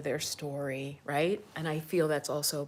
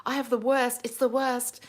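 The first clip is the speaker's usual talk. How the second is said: higher, lightly imitated British accent; 6 semitones higher than usual. British girl begging not to be imitated, light British accent. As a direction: protest with a hint of British vowels. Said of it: "She said something with a British accent".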